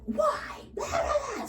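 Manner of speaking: high, shrill mock whine of a drunk. Mocking a drunk regular who was cut off, high whiny wail. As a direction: parody her protest, sky-high.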